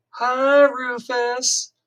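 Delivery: put-on high, loud, sing-song girls' hello; giggly schoolgirls swooning over the new boy; cooing girls. A narrator's reading. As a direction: coo it like girls with a crush. Girls think he is cute.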